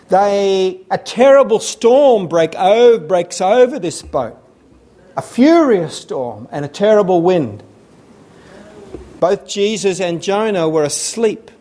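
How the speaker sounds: normal talking voice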